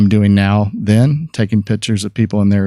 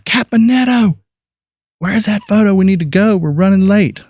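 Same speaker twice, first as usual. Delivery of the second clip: much higher, calling across the newsroom; 10 semitones higher than usual. Retold or imitated. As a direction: call his name from across the room, impatient. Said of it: "I start hearing my name".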